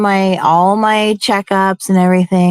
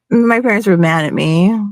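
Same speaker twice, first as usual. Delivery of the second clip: normal talking voice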